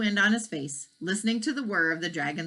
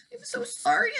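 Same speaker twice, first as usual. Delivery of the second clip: high, teary apology; sorry through tears; huffing, tearful. Acted. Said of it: "huffed the dragon".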